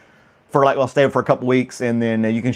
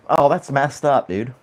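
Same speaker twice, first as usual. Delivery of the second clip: ordinary delivery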